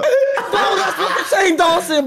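very high, laughing, slow insistence; high laughing claim. Real, not acted. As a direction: squeal it through laughter. Insisting it was Shane Dawson.

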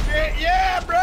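high, loud triumphant yell; pumped-up cheer. Real, not acted. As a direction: holler approval at a teammate. Cheers a play.

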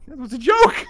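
shouting. Real, not acted. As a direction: shout it out, laughing. Joke falls flat, shouts.

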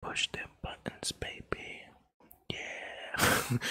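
soft breathy sensual ASMR whisper, very close. Shown as a demonstration. Jokingly showing his stream chat an ASMR voice, whispered. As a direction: whisper sweet nothings like an ASMR host. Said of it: "and I could just be like this".

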